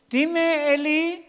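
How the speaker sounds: high, loud, eager reply; acted young servant responding; Samuel. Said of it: "como Samuel".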